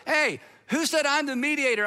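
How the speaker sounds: retold retort, mildly yelled; mock yell back. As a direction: push back as if calling across a crowd. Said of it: "yelled back".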